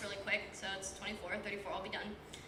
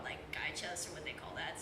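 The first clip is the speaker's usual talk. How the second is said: whispered aside, lower; embarrassed whisper; 7 semitones lower, 9 dB quieter than usual. Real, not acted. Embarrassed aside about anatomy.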